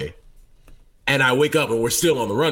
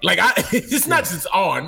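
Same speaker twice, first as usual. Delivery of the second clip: ordinary talk, nothing marked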